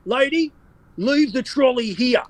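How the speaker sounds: high accent impression with a slow, stretched finish; Con Constantine accent. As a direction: land the punchline in the high put-on accent. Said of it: "and he goes".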